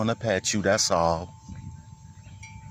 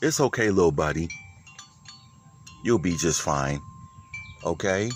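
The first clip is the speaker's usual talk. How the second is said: soothing the kitten; 4 dB quieter than usual. Real, not acted. Calming the animal down.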